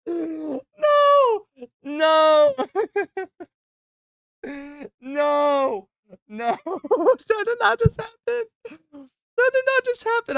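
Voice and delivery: high squealing laughter, disbelief; laughing in shock, can't believe it. Real, not acted. Friend spits drink at joke.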